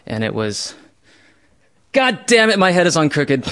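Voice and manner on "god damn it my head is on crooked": loud, high frustrated curse; hammed-up angry self-talk, loud and high; angry outburst. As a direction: blurt it out in retold fury. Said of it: "moved right into anger".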